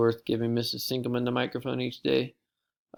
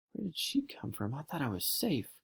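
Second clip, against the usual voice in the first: startled hushed thought; 2 semitones higher, 9 dB quieter than usual. Acted.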